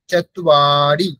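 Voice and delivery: চত্বারি drawn out syllable by syllable, cha-twa-ri; very slow clear চত্বারি; slow careful. Shown as a demonstration. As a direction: spell out চত্বারি slowly and firmly. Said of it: "একটু বেশি সময় দিতে হবে".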